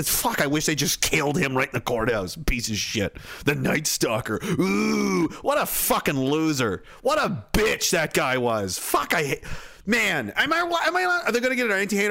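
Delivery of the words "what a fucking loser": ordinary talk, nothing marked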